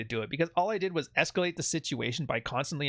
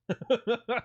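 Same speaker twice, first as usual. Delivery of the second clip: real laugh; 8 semitones higher than usual. Real, not acted. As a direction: laugh out at yourself. Laughing at his own joke.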